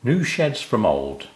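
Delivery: plain reading voice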